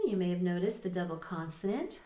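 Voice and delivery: ordinary talk, nothing marked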